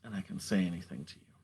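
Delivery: soft and low, almost to yourself; hushed confiding. Acted. Poet hushed and close, confiding to the listener. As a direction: confide it quietly to one listener. Intimate quiet opening to a listener.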